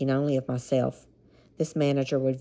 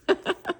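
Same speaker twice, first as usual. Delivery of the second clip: high, short giggle. Real, not acted. Reader amused by the lovers' meeting, giggling. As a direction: break into a quick laugh.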